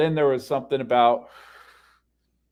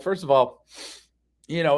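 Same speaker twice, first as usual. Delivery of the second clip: normal talking voice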